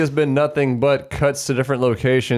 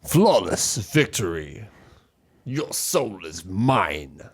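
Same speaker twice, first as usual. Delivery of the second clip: slow, low announcer boom; deep game announcer; 5 semitones lower, 4 dB quieter, much slower than usual. Retold or imitated. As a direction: intone like a fighting game announcer. Imitating game announcer lines.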